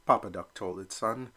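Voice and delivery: ordinary delivery, nothing marked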